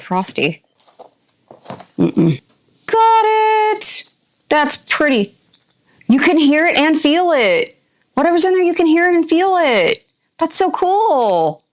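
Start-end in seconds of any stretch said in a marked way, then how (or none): 2.8-11.6 high-pitched, drawn-out marvelling; thrilled, voice pitched way up; delighted excitement. Real, not acted. Delighted at getting the bath bomb out.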